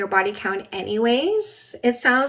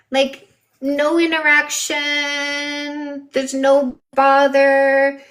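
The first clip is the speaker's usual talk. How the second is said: slow and emphatic; 4 semitones higher, 5 dB louder, much slower than usual. Real, not acted. Stressing how easy blocking is.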